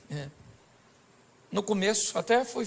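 normal talking voice

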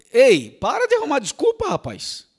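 loud, high scolding shout; loud rebuke to the congregation; scolding call. Real, not acted. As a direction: snap them out of their excuses. Preacher scolds excuse makers.

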